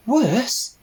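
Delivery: high eager little pig; piglet voice. Acted. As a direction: ask it like a keen young piglet.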